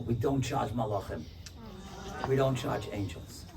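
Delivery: reverent. Retold or imitated. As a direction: let it land softly. Punchline said reverently.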